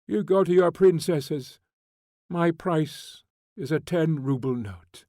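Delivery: loud, high, bitter scorn; a convict hurling contempt at a prince; furious tirade. A narrator's reading. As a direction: lash him with every word.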